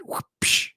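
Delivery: breathy whoop then a whip crack psh; voiced sound effect of a whip. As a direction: mimic a whoosh and a whip snap. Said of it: "the sound effect at the end of the whoop, psh, that whip sound".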